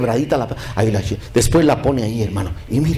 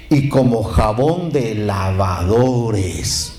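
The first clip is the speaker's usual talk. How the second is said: slow, stretched-out recitation; solemn scripture reading; 4 semitones lower, much slower than usual. Retold or imitated. Preacher reading Malachi to the congregation, slow and solemn. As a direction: draw out each word of the verse. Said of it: "mire lo que dice".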